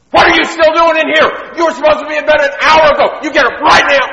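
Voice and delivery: furious high-pitched screaming tirade; mad mom. Acted. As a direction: scream at your son at the top of your voice. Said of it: "now she's mad".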